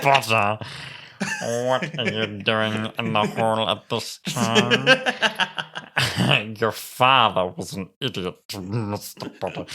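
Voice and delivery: slow, drawn-out mock-stern professor; Severus. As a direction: draw it out, breaking into laughter. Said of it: "Come on, Severus".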